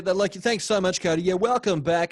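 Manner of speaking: loud, upbeat radio host; bright DJ welcome back; breakfast radio voice. Pretend. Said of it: "You can throw the Breakfast Radio voice up".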